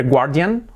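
guardian wrongly as GWAR-dyan; pronunciación incorrecta. Shown as a demonstration. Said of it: "Yo decía siempre". (guardian mal dicho, 'guar-dian')

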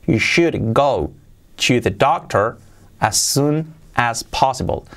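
"You should go... to the doctor" in slow chunks; measured, slow repeat-after-me delivery; slow chunked model. Shown as a demonstration. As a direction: pause between phrases for learners. Teacher models sentence chunk by chunk.

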